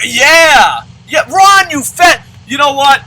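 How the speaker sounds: high, loud cheering yell; cheering his player, real excitement; excited shout. Real, not acted. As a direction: roar for the lucky hit. Celebrating player's hit.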